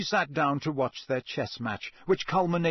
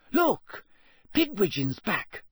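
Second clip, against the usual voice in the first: high, sudden exclamation; excited cry; 7 semitones higher, slower than usual. A narrator's reading. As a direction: break off and cry out at the sight. Spots the owl, interrupting the talk.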